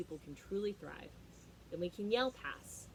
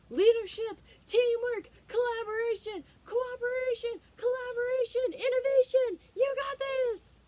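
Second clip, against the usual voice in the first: high, much louder shouting of buzzwords; mock yell; 11 dB louder than usual. A leader shouting buzzwords at the team, mock yell. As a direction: bellow the buzzwords like the boy.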